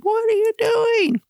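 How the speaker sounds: a hammed-up creature crying out; creature's anguished cry. Creature sees his mate destroyed.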